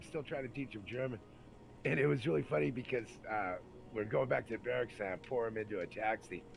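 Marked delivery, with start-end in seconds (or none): none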